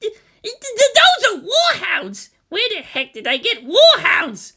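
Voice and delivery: stammering, high and loud in panic; panicked yelp. Acted. Kensuke yelping at the command center, stammering high panic. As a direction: sputter it out in alarm. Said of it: "Kensuke Ada yelped".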